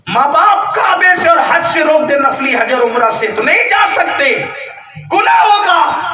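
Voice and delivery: high, loud preaching warning; preaching shout. Acted. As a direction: thunder the warning at the crowd. Preacher warns about parents' ban. (اونچی آواز میں گرجتا ہوا وعظ)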